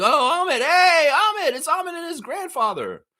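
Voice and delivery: high, loud spotting-a-friend call; spotting Ahmet at the event, high and loud; excited calling out. Pretend. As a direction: call out across the room.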